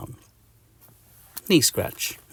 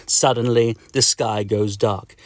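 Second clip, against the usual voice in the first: ordinary delivery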